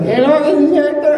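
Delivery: very high put-on Hector voice. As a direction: squeak out a silly character as Hector. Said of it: "He's like".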